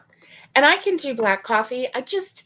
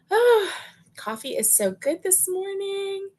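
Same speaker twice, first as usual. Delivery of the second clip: delighted exclamation; 10 semitones higher than usual. Real, not acted. Sipping coffee live for her viewers, delighted and high-pitched. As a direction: swoon over the coffee, way up high.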